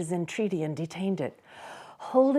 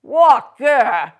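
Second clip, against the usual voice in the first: loud mocking exclamation; incredulous boy; 6 semitones higher, 11 dB louder than usual. Boy exclaiming in disbelief at Scrooge. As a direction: scoff loudly in disbelief. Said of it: "exclaimed the boy".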